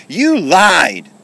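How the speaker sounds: loud, high wail of accusation; upset cry. Acted. Kenny accusing the toy soldier of lying, upset wail. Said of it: "he began to cry".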